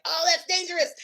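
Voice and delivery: high, loud, startled "Oh, that's dangerous"; the survival system jumping at a threat; hammed-up alarmed gasp; mock alarm.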